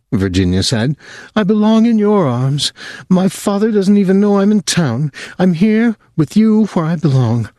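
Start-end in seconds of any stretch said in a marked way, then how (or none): 1.4-7.5 put-on higher, intense voice of a fixated young woman; obsessive young woman. A narrator's reading. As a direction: she clings to him, urgent and possessive. Virginia declares her love.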